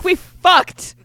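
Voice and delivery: blunt Archie. Retold or imitated. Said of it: "He's like".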